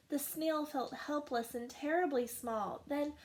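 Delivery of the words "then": usual speaking voice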